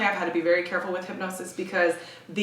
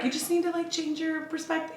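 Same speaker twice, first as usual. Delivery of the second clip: high, softer sugary advice voice; mock super-positive; 7 semitones higher, 5 dB quieter than usual. Client mocking positive-thinking advice to hosts, high and airy. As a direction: parody empty positivity with a lifted, lighter pitch. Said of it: "All that positive, super positive B S".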